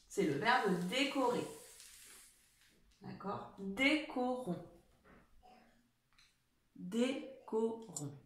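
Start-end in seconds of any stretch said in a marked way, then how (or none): 3.6-4.7 slow and soft, each syllable clear; décorons drawn out slowly for pupils; slow dictation. Shown as a demonstration. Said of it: "je commence à vous la dicter". 6.8-8.2 very slow and soft, syllable by syllable; décorons pronounced at half speed; slower dictation. Shown as a demonstration. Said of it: "je commence à vous la dicter".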